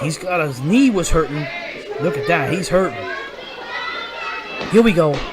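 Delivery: live play-by-play call; wrestling commentator. Real, not acted. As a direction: call the match as it happens.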